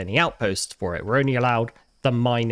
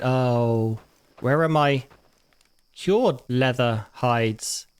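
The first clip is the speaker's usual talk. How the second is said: usual speaking voice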